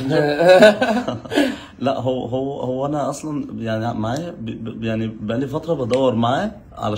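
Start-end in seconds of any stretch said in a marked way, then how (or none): 0.0-1.2 drawn-out word broken by laughter; slow laughing insistence. Acted. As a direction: insist while cracking up.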